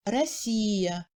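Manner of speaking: "Россия" with a long I; long stressed I. Shown as a demonstration. Teacher comparing vowel length, "Россия" with long I. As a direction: stretch the И in "Россия". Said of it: "on entend le I beaucoup plus long".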